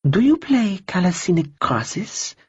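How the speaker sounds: a cool, polite stranger brushing him off; lightly voiced woman. Woman character voice.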